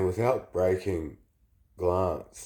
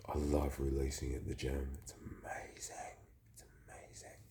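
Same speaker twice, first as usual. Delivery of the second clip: whisper; 4 semitones lower, 11 dB quieter than usual. Real, not acted. Confiding gym release, whispered.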